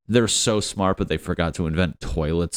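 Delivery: ordinary talk, nothing marked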